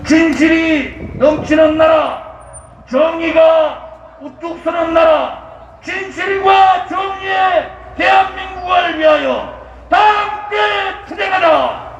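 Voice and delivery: roaring rallying speech. Acted. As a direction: rouse the crowd like a rally leader. (군중을 선동하는 우렁찬 연설)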